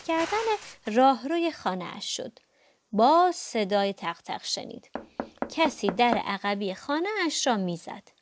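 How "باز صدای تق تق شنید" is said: plain reading voice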